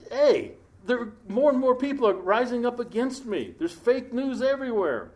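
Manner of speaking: a king fed up with the crowd; complaining king. Acted. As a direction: play David griping about his enemies. Voicing David's complaint.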